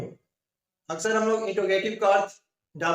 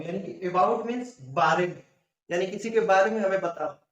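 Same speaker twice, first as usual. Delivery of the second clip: ordinary delivery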